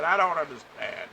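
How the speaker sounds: mock her fed-up outburst; frustrated. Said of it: "frustrated as hell".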